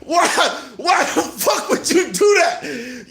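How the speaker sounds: high, drawn-out incredulous cry; real laughing disbelief. Real, not acted. Comic asking why the rapper did that, cracking up. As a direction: wail it through helpless laughter.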